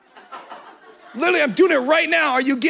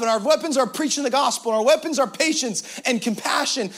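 normal talking voice

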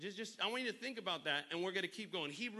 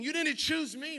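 preaching; 10 semitones higher, 8 dB louder than usual. Acted. Scripture repeated at full force.

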